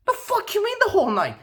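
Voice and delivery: high shocked question; shocked, rising disbelief; incredulous. Real, not acted. Guy demands what she means, high and incredulous.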